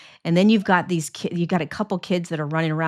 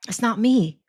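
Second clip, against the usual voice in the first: repeated mantra; 4 semitones higher than usual. Shown as a demonstration. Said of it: "it's a practice that we have to".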